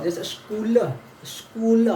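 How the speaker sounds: स्कूल with a clear "sh"-letter example, loud on the repeat; teacher models स्कूल; pronunciation demo. Shown as a demonstration. Teacher gives an example word for the letter, pronunciation demo. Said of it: "ऊपर है स्कूल पढ़ाएगा".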